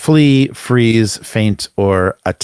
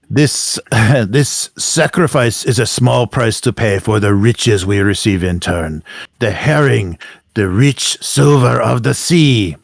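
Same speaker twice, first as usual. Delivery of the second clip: Zacharias; 2 semitones higher than usual. Acted. Game master as Zacharias warns of doom, grandly declaiming. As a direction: declaim it grandly, stretching key words. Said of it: "What's his voice?".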